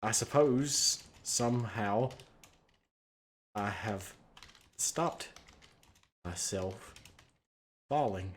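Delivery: slow, halting deadpan with long pauses; stilted, drawn-out musing; monotone. Acted. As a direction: deliver it word by word, quietly. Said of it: "you say in a monotone voice".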